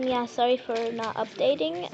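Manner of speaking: ordinary delivery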